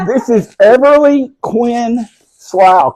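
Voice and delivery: slow, loud, high announcement; grand introduction. Real, not acted. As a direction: roll out the full name proudly. Announcing the child's full name.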